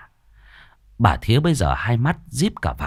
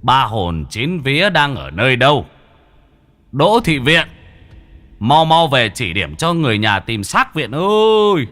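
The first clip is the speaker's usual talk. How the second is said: distant ritual calling; 3 semitones higher, 4 dB louder than usual. A narrator's reading. Villagers calling the dead girl's soul from the riverbank, long ritual call. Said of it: "Âm thanh như từ xa tận bến sông vọng vào".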